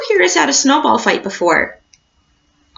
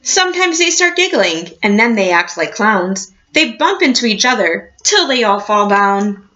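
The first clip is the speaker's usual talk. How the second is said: put-on reading to children. A narrator's reading. Teacher reading a picture book to her class, storybook voice.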